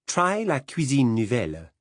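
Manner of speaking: put-on la cuisine nouvelle said with a proud French accent. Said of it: "proud of her French accent".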